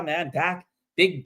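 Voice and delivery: ordinary delivery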